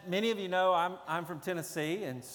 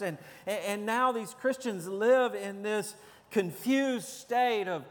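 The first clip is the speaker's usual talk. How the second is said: higher, louder preaching drive; full-force preaching; 4 semitones higher, 4 dB louder than usual. Real, not acted. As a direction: preach it with rising urgency to the congregation. Preaching on First Peter.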